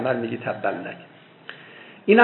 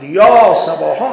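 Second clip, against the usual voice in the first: sudden much louder cry, pitch lifted; loud call; 4 semitones higher, 10 dB louder than usual. A narrator's reading. The Prophet summoning people from Safa, loud rising call. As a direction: shout the alarm to summon everyone. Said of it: "با صدای بلند فرمود".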